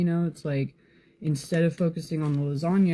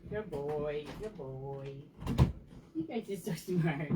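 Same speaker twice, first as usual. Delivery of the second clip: talking to a dog; 9 dB quieter, slower than usual. Real, not acted. Praising dogs at the back door.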